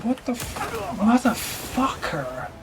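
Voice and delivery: high, drawn-out curse of disbelief; genuinely incredulous and annoyed; exasperated swearing. Real, not acted. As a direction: stretch the swear out slowly in frustration. Swearing after failing again.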